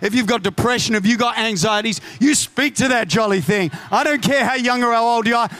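ordinary delivery

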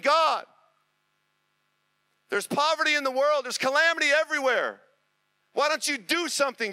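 loud, high, pleading cry to heaven; crying out to God. Acted. Man in the song crying out to God, loud and pleading. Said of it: "he's crying out to God".

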